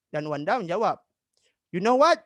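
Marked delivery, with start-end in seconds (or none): 1.7-2.2 high, loud, rising teaser; bursting to share news, acted; excited news opener. Wanda about to tell Ratu some news, acted excitement.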